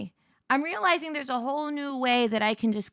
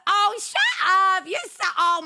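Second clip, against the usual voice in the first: high, louder, slow delighted squeal; squealing shut up; 8 semitones higher, 6 dB louder than usual. Real, not acted. Streamer to chat, squealing with flattered delight. Flustered reaction to viewer.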